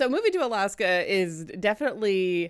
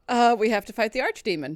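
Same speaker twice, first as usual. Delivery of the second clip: put-on light English accent; 5 semitones higher, 5 dB louder, faster than usual. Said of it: "that's what I use for Caladry when I'm talking in character voice".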